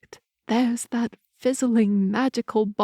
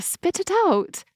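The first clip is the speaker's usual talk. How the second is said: demanding, pitch jumping up high; impatient demand; 11 semitones higher than usual. Acted. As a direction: snap it out, much higher.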